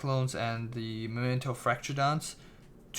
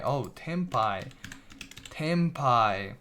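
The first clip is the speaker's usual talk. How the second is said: usual speaking voice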